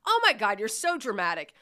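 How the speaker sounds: loud, high exasperated teasing. Retold or imitated. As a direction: roll your eyes at him. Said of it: "and I'm like".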